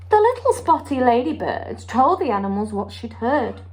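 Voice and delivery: lively storybook read-aloud with character voices; story time voice for a children's picture book. Acted. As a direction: read it to a class of little ones, bright and expressive.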